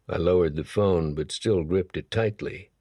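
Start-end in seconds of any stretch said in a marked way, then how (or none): none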